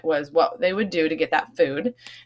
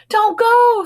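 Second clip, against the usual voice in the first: squeaky mouse voice, loud and high; high squeak; 9 dB louder than usual. Acted. Frightened mice squeaking a warning to their friend. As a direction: squeak it out in alarm. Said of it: "squeaked her friends".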